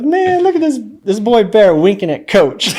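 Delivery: teasing teammate. Retold or imitated. Teammate laughing at the rookie in the hallway, high mocking voice. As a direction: ham up a teammate ribbing you.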